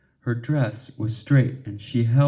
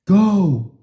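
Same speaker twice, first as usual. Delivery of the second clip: urgent push; 6 semitones higher, 6 dB louder than usual. A narrator's reading. Urging frozen Ginger.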